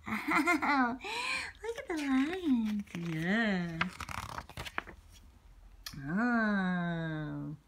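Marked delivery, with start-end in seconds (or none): none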